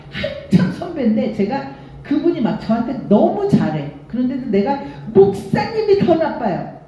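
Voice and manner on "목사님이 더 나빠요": high-pitched indignant mock yell; accusing cry pitched up high; retold yell. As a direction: re-enact yelling at a senior pastor. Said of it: "소리를 빽 질렀더니".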